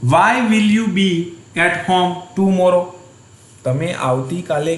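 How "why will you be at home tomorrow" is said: slow dictation. Shown as a demonstration. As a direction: read it out slowly for the class to write. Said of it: "teacher dictates English question".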